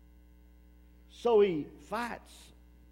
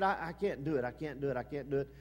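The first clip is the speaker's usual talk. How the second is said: low, quiet and defeated; a hint of hopeless pleading; mild despairing refusal; 6 semitones lower, 5 dB quieter, much faster than usual. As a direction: let the words sink as if giving up. Preacher voices his own refusal.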